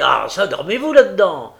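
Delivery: loud, high rebuke; exasperated call into the office; impatient courier calling. A narrator's reading.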